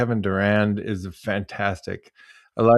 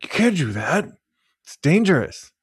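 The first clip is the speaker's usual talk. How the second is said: higher, alarmed mock warning; half-hearted higher-pitched alarm; mock scolding; 7 semitones higher than usual. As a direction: imitate an attendant scolding a driver. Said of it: "they will shout at you".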